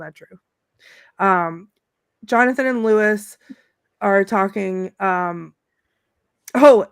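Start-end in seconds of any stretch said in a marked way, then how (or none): none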